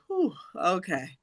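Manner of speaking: soft, drawn-out mock sigh of relief; relieved sigh. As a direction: let out a quiet whew, then settle slowly. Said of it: "a sigh in unison in the theater".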